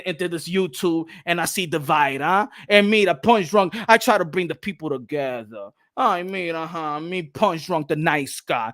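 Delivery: usual speaking voice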